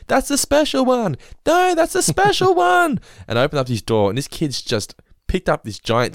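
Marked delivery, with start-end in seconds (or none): 0.0-1.2 high, slow, wailing yell; imitated child scream. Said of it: "I just see Elliot screaming". 1.5-3.0 very high, louder, slow wail breaking into a laugh; louder imitated child scream. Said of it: "I just see Elliot screaming".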